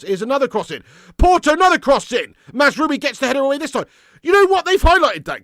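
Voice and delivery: high, loud commentary on repeated crosses; loud worried play-by-play; alarmed shout. Real, not acted. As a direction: build the alarm with each cross. Live cross commentary, rising alarm.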